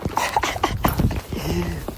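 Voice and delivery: breathy burst of laughter; real laughter. Real, not acted. Leaving the post office with the parcel, laughing.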